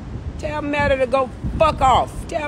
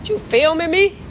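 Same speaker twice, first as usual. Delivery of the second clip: confronting; 5 semitones higher than usual. Real, not acted. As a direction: call out sharply at whoever's there. Confronting someone filming her.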